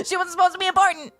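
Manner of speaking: high, loud and fast, hammed-up indignation; mock outraged outburst. Fan repeating the writer's stance as a mock outraged outburst. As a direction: blurt it out as a mock protest.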